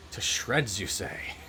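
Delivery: a hint of a doddery old professor, mildly puzzled; lightly voiced old professor. Professor taking a call, lightly puzzled old voice. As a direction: ask it lightly, like an absent-minded old man. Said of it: "he's just like".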